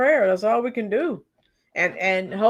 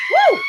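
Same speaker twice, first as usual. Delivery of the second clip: loud high whoop; 7 dB louder than usual. Real, not acted. Cheering a viewer's answer.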